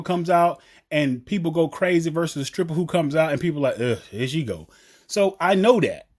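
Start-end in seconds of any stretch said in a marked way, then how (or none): 3.8-4.7 low, soft, underwhelmed mock groan; unimpressed groan. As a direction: imitate a let-down crowd, low and quiet. Imitating an unimpressed strip club crowd.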